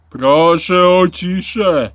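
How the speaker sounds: loud, drawn-out yell for silence. A narrator's reading. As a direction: yell slowly over a noisy line of pupils. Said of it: "wrzasnął Rosół". (głośny, przeciągły wrzask)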